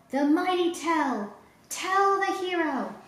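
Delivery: high, slow cheering shout; crowd shouting. Acted. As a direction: cheer the hero. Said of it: "the people shouted".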